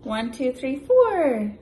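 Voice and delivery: lively, loud picture-book read-aloud; animated storybook voice for a little one; reading to a child. Acted. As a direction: read it big and bouncy for a toddler.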